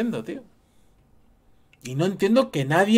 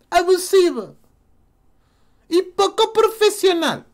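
high mocking voice; mock quote; 14 semitones higher, 4 dB louder than usual. Vlogger contrasting his question with the mocked excuse: "Abusivo" high sneer.

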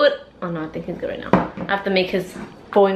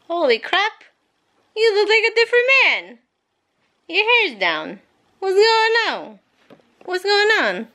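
high, fast, amazed exclamations; high surprise; 10 semitones higher than usual. Real, not acted. Wife startled by husband's changed hair, high surprise. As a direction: gasp it out in astonishment.

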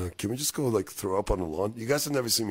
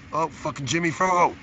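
calling out; 9 semitones higher, 5 dB louder than usual. Real, not acted. Calling out to the dog.

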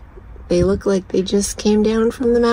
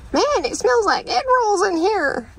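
imitating a hillbilly stranger; 14 semitones higher than usual. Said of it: "Some hillbilly".

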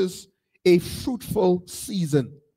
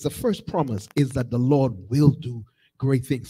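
ordinary delivery

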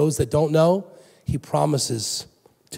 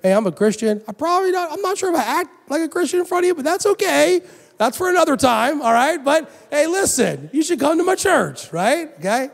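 high, quick, awkward invite to a friend; nervous church invite; 12 semitones higher than usual. Retold or imitated. Inviting a skeptical friend to church, nervously high. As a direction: talk way up and hurry, unsure of yourself. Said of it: "You're like".

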